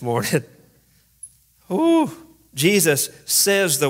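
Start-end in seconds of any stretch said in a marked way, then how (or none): 1.7-2.1 a laugh rising into a high loud ooh; a delighted squeal at the chaos; gleeful high-pitched ooh; amused ooh. Real, not acted. Preacher reacting to the outburst.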